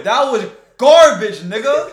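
drawn-out loud diss. Real, not acted. Mocking friend's joke.

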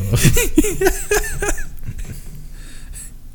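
long high-pitched laugh; high giggle. Real, not acted. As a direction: cackle up high. Long laugh after grandma bit.

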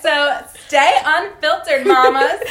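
slow, loud, drawn-out catchphrase; podcast sign-off. Pretend. Host signing off to listeners, slow emphatic catchphrase. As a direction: stretch it out like a show's closing slogan. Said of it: "We have a sign-off".

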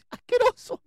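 very high, loud mock shriek of disgust; comic outburst of disgust. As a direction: shriek it out, hammed-up revulsion. Mother storms off in disgust.

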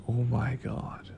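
slow awed whisper. Retold or imitated. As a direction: breathe it out in awe. Awed at the warehouse.

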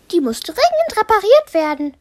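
viel höher und schnell, geschäftiges Mädchen; Piper spricht. Acted. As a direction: sag es schnell und resolut. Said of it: "meinte Piper". (Piper stellt schnell fest, hohe Stimme)

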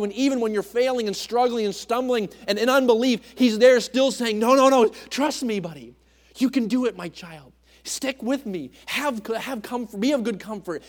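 loud, high preacher's fervour; preaching. Acted. Preacher driving the point home to church family, loud and high.

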